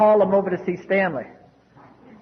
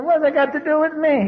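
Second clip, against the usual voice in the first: higher-pitched, put-out retort; indignant protest; 7 semitones higher than usual. Retold or imitated. Newcomer protesting to his sponsor, indignant. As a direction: retell a baffled objection.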